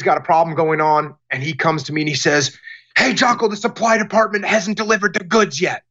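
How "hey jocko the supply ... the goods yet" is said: mildly mad employee. Retold or imitated. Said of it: "And he's mad".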